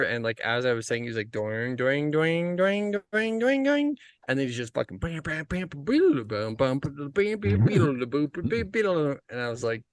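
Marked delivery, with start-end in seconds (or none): 1.3-4.0 sung tuning notes climbing higher each pair; mock guitar tuning. Telling friends how the German guy tuned by ear, rising mock notes. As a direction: hum the strings rising step by step.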